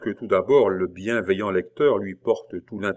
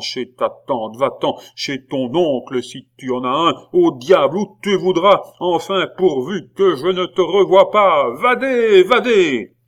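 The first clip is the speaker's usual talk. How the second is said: rising into a loud angry yell at the pupil; furious shout; 7 semitones higher, 8 dB louder than usual. A narrator's reading. Angry abbé shouting down the stairs at Pitou. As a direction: throw him out, shouting. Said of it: "la voix courroucée".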